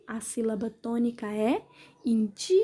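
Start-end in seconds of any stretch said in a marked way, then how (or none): 2.0-2.6 "indi" with DI stretched out; short "in", long held "di" in "indi"; stress on DI. Shown as a demonstration. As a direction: draw out the DI in "indi". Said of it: "a sílaba mais forte é o di".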